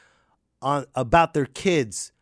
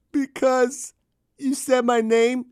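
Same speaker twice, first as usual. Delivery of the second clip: put-on higher kid whine; bratty kid; 8 semitones higher than usual. A kid talking back to the parent. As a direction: answer like a smart-aleck kid.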